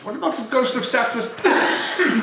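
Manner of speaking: high-pitched question through laughter; laughing. Real, not acted. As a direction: crack up mid-line. Scrooge breaks role, laughing.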